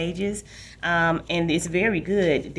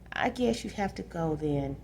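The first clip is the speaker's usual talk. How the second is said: mild sadness; 8 dB quieter than usual. Acted. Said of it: "Danny said sadly".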